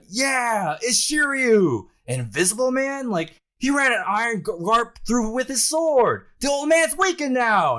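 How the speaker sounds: very high, loud hammed-up jeering; squawky, excited mock-crowd voice; cheering pirate crowd. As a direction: crow like a rowdy crew of henchmen. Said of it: "they're all cheering in the back".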